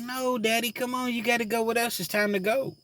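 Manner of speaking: put-on high pleading child voice; imitating his little girl, high and pleading; little daughter voice. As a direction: play his three-year-old begging him. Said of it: "She was like".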